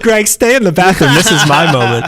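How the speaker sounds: high-pitched, hammed-up plea; mock-earnest bid for the spotlight; mock dramatic plea. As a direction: play it up, shooing a friend away. Acting out the friend's reaction.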